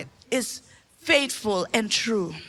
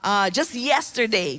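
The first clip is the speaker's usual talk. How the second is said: ordinary talk, nothing marked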